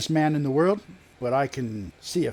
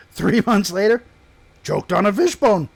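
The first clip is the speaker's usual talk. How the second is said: higher, louder triumphant reveal; gleeful punchline; 7 semitones higher, 5 dB louder than usual. Acted. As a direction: land the punchline with relish. Punchline of gossip tale.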